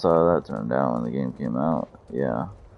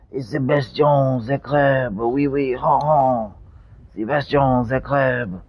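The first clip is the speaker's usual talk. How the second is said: high, loud hammed-up French voice with oui oui and giggles; mock French accent; 7 semitones higher, 6 dB louder than usual. Teasing his friend as a cartoon Frenchman, hammed-up French accent.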